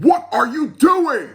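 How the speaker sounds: loud, high, slow angry demand; stern master scolding loudly; angry shout. Acted. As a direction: bark it at the boys, furious. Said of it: "The monk was angry and said".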